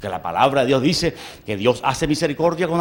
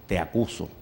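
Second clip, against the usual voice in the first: lower, softer grave accusation; prophet's quiet accusation; 11 semitones lower, 11 dB quieter than usual. Retold or imitated. Prophet Nathan accuses King David, low and grave. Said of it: "Natán, qué le dijo".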